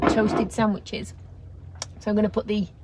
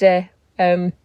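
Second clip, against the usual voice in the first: ordinary talk, nothing marked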